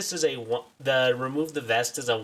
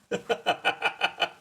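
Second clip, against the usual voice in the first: short staccato chuckle; amused rapid-fire laugh; 4 semitones higher than usual. Real, not acted. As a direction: laugh in a quick, even run. Laughs after own joke.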